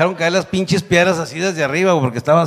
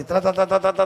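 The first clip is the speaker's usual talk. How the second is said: rapid-fire "tra" rattle; imitated crunch of vertebrae; imitated cracking bones; 3 semitones higher than usual. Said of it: "me tronaba todos los pinches huesos".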